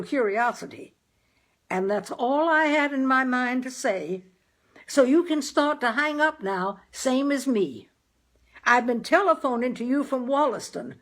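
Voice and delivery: high and loud with relief; father thanks Dan loudly on the phone; relieved loud phone voice. A narrator's reading.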